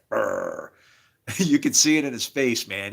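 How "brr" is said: low rolled growling noise; tough-guy growl. Retold or imitated. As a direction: imitate a powerful man with a low trill. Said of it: "gonna grow up looking like".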